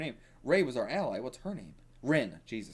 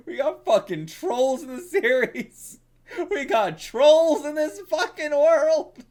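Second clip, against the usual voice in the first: laughing shout; 14 semitones higher, 10 dB louder, slower than usual. Real, not acted. Laughing at trolls.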